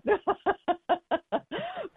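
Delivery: long high-pitched giggle; rapid run of high laughter; real laugh. Real, not acted. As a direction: laugh high at your own idea. Laughs at her own idea.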